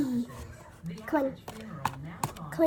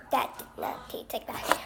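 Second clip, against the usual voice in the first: disgusted whisper; 6 semitones lower, 6 dB quieter than usual. Real, not acted. Disgusted after tasting.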